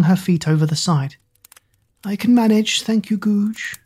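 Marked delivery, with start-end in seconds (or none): 2.0-3.8 put-on high, frail old queen; lightly voiced weak old queen. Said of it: "The frail, weathered woman".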